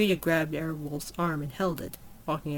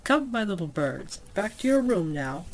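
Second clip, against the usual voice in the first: playful whoop; 4 semitones higher than usual. A narrator's reading. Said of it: "whooping playfully".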